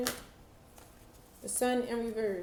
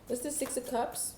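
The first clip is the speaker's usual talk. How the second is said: normal talking voice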